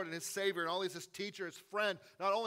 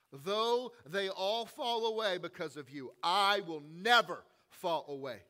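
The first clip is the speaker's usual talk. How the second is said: bold vow; 7 dB louder, slower than usual. Retold or imitated. Peter's bold vow retold.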